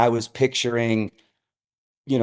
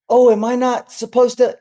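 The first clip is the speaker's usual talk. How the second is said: higher, mock-innocent question; feigned innocence; 10 semitones higher, 3 dB louder than usual. Pastor playing innocent to the stage manager, higher and mock-naive. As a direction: play dumb, all innocence. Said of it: "I just looked at her like".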